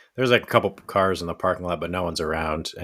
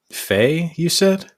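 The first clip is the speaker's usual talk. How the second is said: much higher, pointed query; suspicious question; 10 semitones higher, 4 dB louder, much slower than usual. Acted. Jack asks Ulrich about Faye, higher and doubtful. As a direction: pick at the name, leaning in with doubt.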